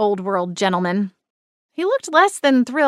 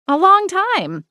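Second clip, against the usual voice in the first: insisting; 11 semitones higher, slower than usual. A narrator's reading. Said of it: "I insisted".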